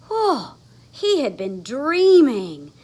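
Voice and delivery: plain reading voice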